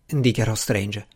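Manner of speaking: ordinary delivery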